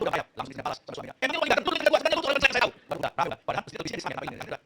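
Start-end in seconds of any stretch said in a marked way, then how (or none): 1.1-2.7 very high, loud comeback; hammed-up indignant wife; acted wife's retort. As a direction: yell back at your husband in a mock row. Acts wife snapping back at husband.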